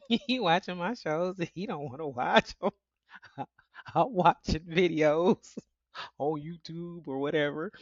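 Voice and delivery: giggling through the words; amused chuckling aside; laughing. Real, not acted. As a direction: laugh while telling it. Laughing about shows.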